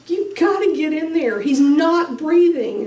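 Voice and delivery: high, pressing urgency; high urgent plea to the paramedics. Retold or imitated. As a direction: push the paramedics to hurry. Urging paramedics.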